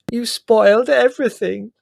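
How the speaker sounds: a loud, high angry outburst. Acted. Margot rounding on Prue for ruining the séance, loud and angry. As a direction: lash out at her, shrill and furious. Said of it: "said Margot angrily".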